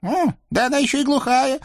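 very high squeaky devil voice, pleading; a scrawny devil begging off; whiny little devil. Acted. Наум ворчит. (писклявый чёрт)

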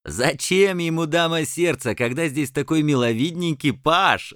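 высокий злорадный голос рыцаря; весело перебить с издёвкой; радостно. Acted. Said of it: "радостно перебил другой". (радостная издёвка рыцаря)

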